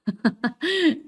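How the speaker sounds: short high laugh. Real, not acted. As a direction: break into a laugh. Laughs after tongue twister.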